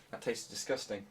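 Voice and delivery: usual speaking voice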